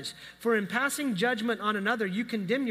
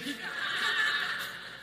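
breathy laugh; 5 semitones higher, 4 dB quieter than usual. Real, not acted. Laughing at reformed teen.

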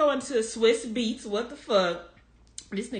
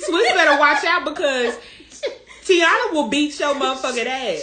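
loud, high, laughing retold warning; 5 semitones higher, 8 dB louder than usual. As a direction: belt out a mock warning through laughter.

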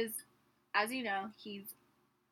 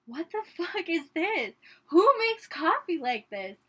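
imitating an irritated dad, pitched up; agitated dad; 6 semitones higher, 7 dB louder than usual. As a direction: retell his exasperated outburst, louder on the question. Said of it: "more agitated as he's pouring coffee".

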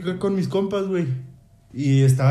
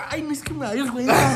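high, giggly embarrassed whine; bashful whine; 7 semitones higher than usual. Retold or imitated. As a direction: play the shy kid, voice climbing. Imita su yo apenado. (voz aguda y apenada entre risas)

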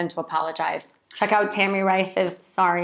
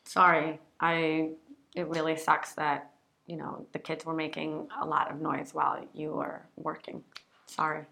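low, soft, subdued apology; lowercase sorry; 3 semitones lower, 8 dB quieter than usual. Shown as a demonstration. Partner sympathizing about noisy kids during work, subdued. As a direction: commiserate softly and hesitantly. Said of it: "low case sorry".

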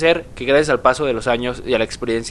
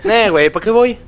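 higher, louder cocky shrug; mock confident musician; 8 semitones higher, 6 dB louder than usual. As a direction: toss it off like a smug star. Voicing [name].

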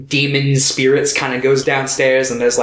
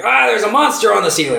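higher, hurried, put-on panic; mock alarm; 8 semitones higher, faster than usual. King Yama spotting a monster on the ceiling, mock alarm. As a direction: blurt a hammed-up warning.

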